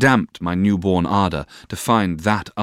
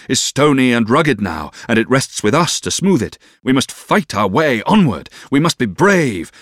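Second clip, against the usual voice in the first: impassioned, rousing oratory, raised voice; warming to it; 5 semitones higher, 5 dB louder than usual. A narrator's reading. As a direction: exhort her with mounting fervour. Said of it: "warming with the subject".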